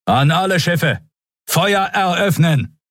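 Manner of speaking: high-pitched battle order; urgent admiral's command, pitched right up; commanding call. A narrator's reading. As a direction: call the order out to the whole fleet. Admiral commands his escort ships.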